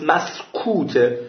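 drawn-out مسکوته with a falling close; slow emphatic. Shown as a demonstration. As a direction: stretch out مسکوته slowly for emphasis. Repeats the key word, drawn out.

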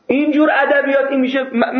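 ordinary delivery

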